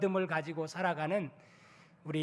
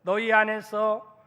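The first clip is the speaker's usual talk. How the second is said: louder, higher, ringing sermon voice; fervent preaching; 5 semitones higher, 8 dB louder than usual. Acted. Pastor stirring the congregation, preaching at full force. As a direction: build each phrase like a pulpit climax.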